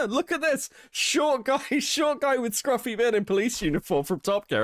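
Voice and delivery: high, laughing mock announcement; a laughing squeal of a discovery; laughing brain voice. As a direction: giggle it out as your own silly brain. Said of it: "held the fucking picture up and went".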